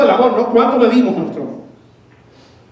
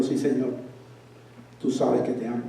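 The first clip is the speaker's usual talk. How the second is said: sad and humble; 10 semitones lower, 13 dB quieter than usual. Retold or imitated. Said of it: "con una cara un poco triste".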